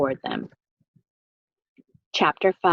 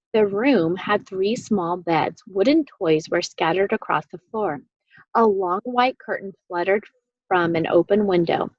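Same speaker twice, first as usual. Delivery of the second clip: ordinary delivery, nothing marked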